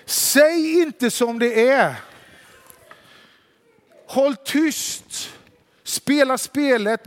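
långsam befallning i högre tonläge; mana någon att hålla masken, med tryck på varje ord; eftertrycklig tillsägelse. Acted. Mimar uppmaningen att spela med. (högljudd, utdragen uppmaning)

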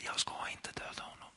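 a firm whispered refusal; David whispering his refusal to his friends. Acted. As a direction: refuse in a hush so nobody hears. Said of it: "De viskar till varandra".

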